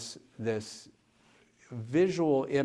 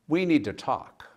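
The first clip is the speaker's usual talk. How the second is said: stern demand; 5 semitones higher, 6 dB louder than usual. Retold or imitated. Angry patient confronts healer.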